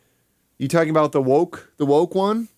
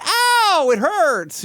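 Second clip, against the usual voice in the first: mock yelp; much slower than usual. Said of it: "it's like".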